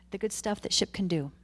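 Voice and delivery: ordinary talk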